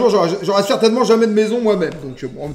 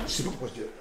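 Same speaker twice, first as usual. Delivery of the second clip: very low, soft mumble to himself; barely voiced puzzlement; hushed self-reproach; 11 semitones lower, 17 dB quieter, much faster than usual. Real, not acted. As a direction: drop right down after yelling. Lowers voice after noticing he yells.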